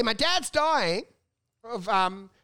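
drawn out, slow, mock grave; slow imitation. Imitating the comic telling about his dying dad, slowly. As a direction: stretch each word out like a gloomy confession. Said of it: "He'll say".

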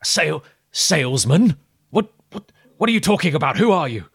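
high, loud baffled questions; startled islander. Acted. GM's hidden islander baffled by the salesman claim, startled. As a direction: stammer in confusion at the word salesman.

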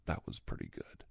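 offhand whispered nod of approval. Retold or imitated. Host imitating someone nodding along, whispered approval. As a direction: mutter it under your breath, impressed. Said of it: "you nod and you go".

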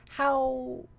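ordinary talk, nothing marked

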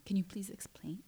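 soft unvoiced whisper; hushed plea to explain; whispered question. Asking date to explain insult.

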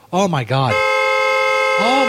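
normal talking voice

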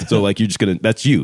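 usual speaking voice

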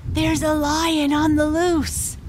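put-on high-pitched little child voice; voiced stage whisper of a child alerting papa; child's stage whisper. A narrator's reading. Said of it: "he whispered".

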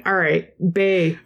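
slow, badly faked Irish accent; mock Irish catchphrase in a poor accent. Shown as a demonstration. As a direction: do a deliberately bad Irish accent. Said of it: "It's easy to do a bad one".

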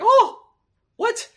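very high surprised squeak; high squeaky voice. Retold or imitated. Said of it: "you sound like one of the eunuchs".

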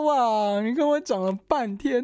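high, disbelieving complaint; exasperated wow, pitched high. Retold or imitated. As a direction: throw up your hands at the wasted talk, pitched high. Repeating the exasperated reaction.

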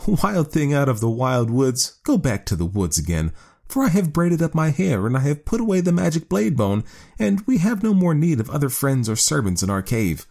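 high woman's voice, laughing him off. A narrator's reading. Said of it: "The woman laughed and said".

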